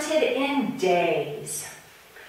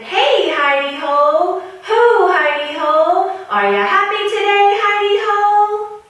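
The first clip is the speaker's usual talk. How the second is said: sing-song call, higher and louder; 11 semitones higher, 9 dB louder than usual. Acted. Rabbit calling to his hidey hole, loud sing-song call.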